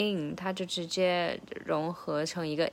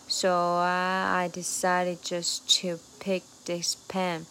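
"I" drawn out over two beats, then slow; stretched I; slower than usual. Shown as a demonstration. Teacher shows the stretched "I" in the lyric. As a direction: stretch "I" to link both sides. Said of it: "把I给拖长了".

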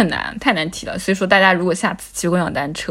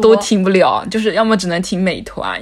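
ordinary talk

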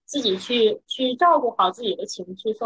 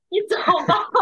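higher, breaking into laughter; laughing retold dismissal; 6 semitones higher than usual. Woman recalling how she sent the worried guard away, laughing. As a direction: say it on the edge of a laugh.

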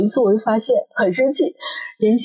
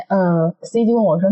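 usual speaking voice

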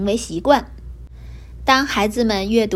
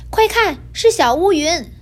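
excited alert; 7 semitones higher, 3 dB louder than usual. A narrator's reading. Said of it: "白猫指着小乌云说".

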